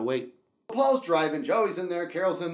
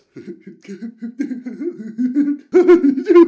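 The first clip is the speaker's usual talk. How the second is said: big booming mock laugh, higher; loud laughter; 8 semitones higher, 13 dB louder than usual. Buddy on the ski lift laughing, loud. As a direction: cackle like a buddy losing it.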